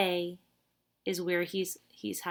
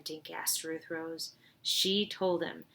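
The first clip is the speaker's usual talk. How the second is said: ordinary delivery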